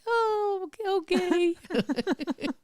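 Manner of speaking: very high, squeaky giddy okay; mock-giddy high okay; high-pitched okay. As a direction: squeal it like a smitten girl. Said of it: "And I was like".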